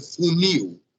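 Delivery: "funil" with the weight on -NIL; final syllable of "funil" strong, then falling; stress on last syllable. Shown as a demonstration. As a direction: model "funil" as foo-NEEW. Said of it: "a sílaba forte é a última sílaba".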